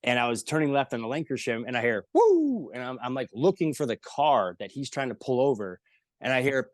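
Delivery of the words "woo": high, loud siren "woo"; imitated police siren. Driver hears police behind him on Lankershim, imitated siren.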